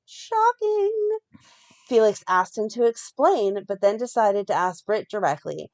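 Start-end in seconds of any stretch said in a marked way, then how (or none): 0.1-0.9 high, hammed-up shocked gasp of a word; mock shock. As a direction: play up the soap drama. Mock reaction to soap twist.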